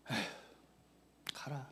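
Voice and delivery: sigh, then a whispered go; resigned father whispering go. Retold or imitated. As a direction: give up and let him go. Father sighing at son.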